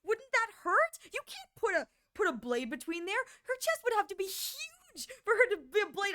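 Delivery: high, puzzled mock-mom voice; a baffled mother, pitched well up; mimicking mom. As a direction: daughter imitating her confused mom. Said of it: "She's like".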